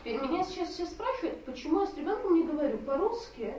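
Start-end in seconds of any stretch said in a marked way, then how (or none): none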